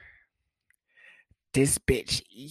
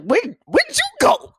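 shrill high mocking echo; 6 dB louder than usual. Boyfriend mocking his girlfriend's question, shrill and high. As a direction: parrot her right back, way up high and loud. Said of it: "I was like".

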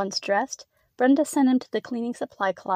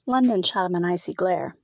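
usual speaking voice

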